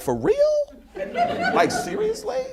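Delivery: very high, laughing disbelief; incredulous. Retold or imitated. [name] reacts to [name]'s sudden fame, very high laughing disbelief.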